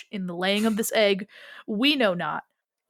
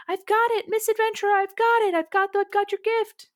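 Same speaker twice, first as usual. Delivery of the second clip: put-on high, loud eager child voice; excited little boy; 11 semitones higher, 4 dB louder, faster than usual. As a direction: call out proudly to the adventurer. Excited kid drags basket out.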